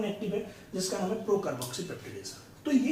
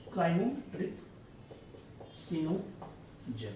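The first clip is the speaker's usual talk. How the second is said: chymotripsinogen drawn out syllable by syllable, softer; very slow dictation; 3 semitones lower, 6 dB quieter, much slower than usual. Shown as a demonstration. Teacher slowly dictating chymotripsinogen for students' notes. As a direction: crawl through chymotripsinogen for writing it down. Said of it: "मैं आपको यहाँ पे लिखता हूँ".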